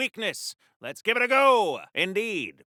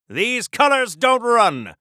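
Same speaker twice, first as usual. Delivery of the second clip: defiant declaration; 3 semitones higher, 7 dB louder, slower than usual. Acted. Defiant combat bark.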